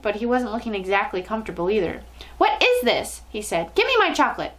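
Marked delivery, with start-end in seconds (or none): 2.4-3.2 put-on high-pitched indignant boy; demanding boy. A narrator's reading. Bruno to the witch on stage, demanding. 3.7-4.5 high-pitched, petulant demand; demanding boy. A narrator's reading. Bruno wanting his promised chocolate, demanding.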